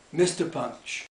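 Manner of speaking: usual speaking voice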